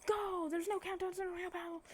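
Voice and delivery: high-pitched mock shout to go; urgent start signal. Acted start signal to the student.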